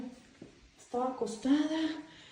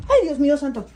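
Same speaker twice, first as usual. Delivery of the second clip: startled cry; 3 semitones higher, 10 dB louder than usual. Real, not acted. Alone at night, doll moves.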